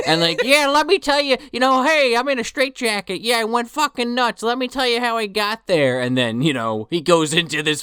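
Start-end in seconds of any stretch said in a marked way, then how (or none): 0.4-6.0 straitjacket confessional voice. Retold or imitated.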